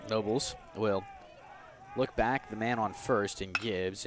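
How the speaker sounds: usual speaking voice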